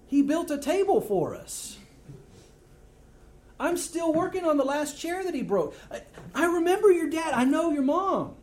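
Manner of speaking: high, loud, incredulous Pharisee voice; mocking Pharisees. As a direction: scoff at him like a skeptical neighbor. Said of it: "And the Pharisees kinda go".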